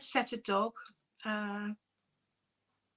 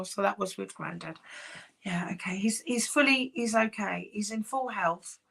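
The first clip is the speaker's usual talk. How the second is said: ordinary talk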